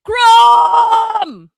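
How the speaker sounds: loud, high battle-cry shout; Crom scream. Pretend. Asked for his best Crom scream, shouting. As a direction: roar the name out on request. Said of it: "Give me your best Crom scream".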